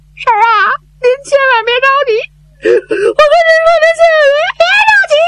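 high, tearful voice breaking; crying plea. Acted. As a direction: sob out the comfort to the aunt. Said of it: "你别哭". (哭着高声劝婶子别着急)

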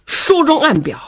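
ordinary delivery

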